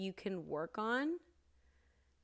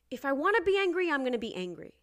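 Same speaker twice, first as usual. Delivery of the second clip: higher, loud burst, easing off; assertive, raised-voice venting; defiant anger; 7 semitones higher, 10 dB louder, much faster than usual. Acted. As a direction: let the anger burst out fast, then settle. Venting her right to anger.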